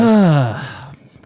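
big loud exhale; loud sigh. Real, not acted. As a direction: let out a heavy, audible sigh. Loud sigh after the bit.

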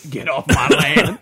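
slow, loud country grump. Pretend. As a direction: drawl it like an angry farmer. Redneck farmer voice.

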